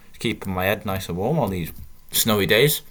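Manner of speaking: ordinary talk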